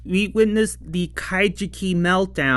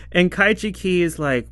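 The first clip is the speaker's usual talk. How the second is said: normal talking voice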